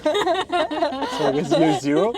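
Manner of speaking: high, slow, through laughter; laughing. Real, not acted. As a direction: say it while giggling. Joking aside, laughing.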